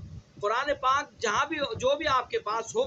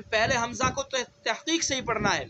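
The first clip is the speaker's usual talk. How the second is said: ordinary delivery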